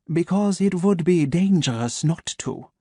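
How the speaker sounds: measured German doctor, each word placed; a calm German voice waking his captive; German accent. Acted. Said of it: "his German accent crisp and sharp".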